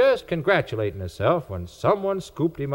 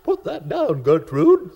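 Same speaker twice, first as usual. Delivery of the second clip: a slightly higher, stern nurse; nurse scolding; 6 semitones higher, 3 dB louder, slower than usual. A narrator's reading. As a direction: tell the child off firmly. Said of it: "says her nurse".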